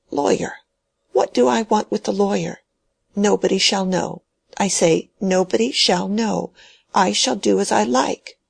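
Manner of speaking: put-on an old man clinging to his will, pressing his point; stubborn dying old man. Dying old man ordering Mary to take the key, high and insistent.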